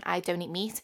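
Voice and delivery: ordinary delivery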